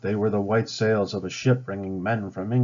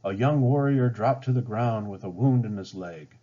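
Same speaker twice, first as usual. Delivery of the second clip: ordinary delivery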